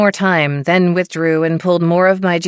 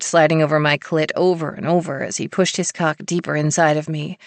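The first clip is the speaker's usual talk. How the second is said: ordinary delivery, nothing marked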